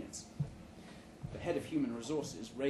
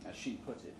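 ordinary delivery

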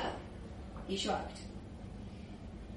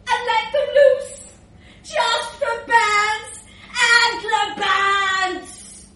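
high, loud, stretched chanting; rowdy boasting; 7 semitones higher, 8 dB louder, slower than usual. Acted. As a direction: build to a roared party chant. Bragging lad builds to chant.

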